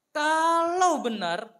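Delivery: loud and slow, leaning on each word; emphatic warning. Acted. Pastor warning the bitter mother, loud and slow. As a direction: drag out the warning. Preacher warns the woman.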